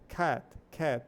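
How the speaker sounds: cat said with a wide open a and a clean t at the end; cat com som mais aberto. Shown as a demonstration. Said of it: "é um som mais aberto".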